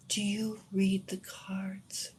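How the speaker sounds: slow, soft and breath-light; hushed question. Retold or imitated. Reader asks her about the cards, hushed and slow. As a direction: ask it slowly, almost whispering.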